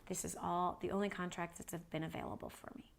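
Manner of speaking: soft, low, resigned reply; quiet resignation. Retold or imitated. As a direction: answer the protest very softly, accepting it. Worker answers her protest softly.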